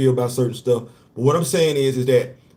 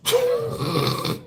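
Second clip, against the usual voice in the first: breathy, voiceless laughing fit; 5 dB quieter than usual. Real, not acted. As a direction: laugh it out without voice. Laughs after his own quote.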